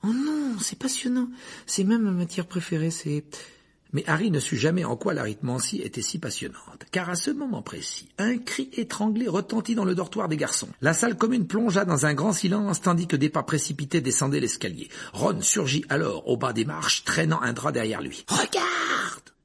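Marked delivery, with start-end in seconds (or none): 0.0-3.2 high, bright-eyed schoolgirl gush; light up about her favourite subject; high, gushing Hermione voice; eager enthusiasm. A narrator's reading. 18.3-19.3 high furious shout; yelled. A narrator's reading. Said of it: "hurla-t-il".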